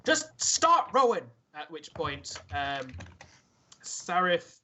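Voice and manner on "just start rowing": high, drawn-out shouted order; shouting. Acted. Dwarf at the tiller to rowers, shouting. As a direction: bellow it across the boat, exasperated. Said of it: "just shouts".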